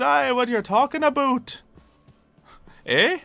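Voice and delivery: high, loud hammed-up Canadian accent; joke Canadian accent. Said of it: "I can joke around about Canadian accent".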